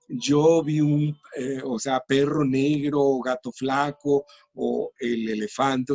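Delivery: ejemplos dichos muy despacio, palabra por palabra; ejemplos lentos. Shown as a demonstration. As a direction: pronuncia cada ejemplo lento y claro. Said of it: "le vas agregando". (ejemplos de frases dichos muy despacio)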